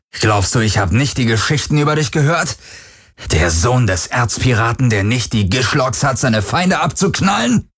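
provoking jeer that rises into a shout; mocking taunt. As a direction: taunt building to a high shouted question. Villain taunts the armed boy.

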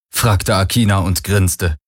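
usual speaking voice

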